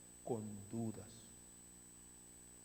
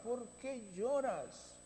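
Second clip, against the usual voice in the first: slow, higher, firm questioning; slow rebuke; 7 semitones higher, slower than usual. Shown as a demonstration. Jesus chiding the weeping woman, slow firm questioning. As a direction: ask it slowly as a reproach. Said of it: "No hay razón de llorar".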